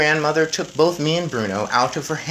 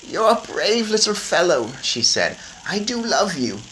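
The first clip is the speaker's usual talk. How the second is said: high, gentle granny voice; tender grandmother; 6 semitones higher, 3 dB louder than usual. A narrator's reading. Grandmother comforting her nervous grandson, tender. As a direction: tell him lovingly.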